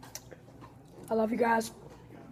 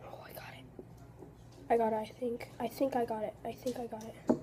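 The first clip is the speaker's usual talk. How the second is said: soft, strained, mouth full; strained muttering; 9 dB quieter, faster than usual. Real, not acted. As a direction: mutter while forcing it down. Forcing hot peppers down.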